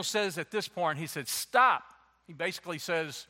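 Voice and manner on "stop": sharp, loud, higher "Stop."; commanding stop. Acted. Samuel silencing Saul, a loud sharp command. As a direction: cut him off dead.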